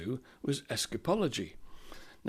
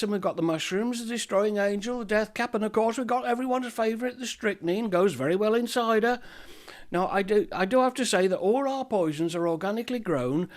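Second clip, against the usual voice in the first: chirpy shopkeeper; 7 semitones higher than usual. Acted. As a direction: greet him like a fussy shopkeeper. Apothecary recommends poisons.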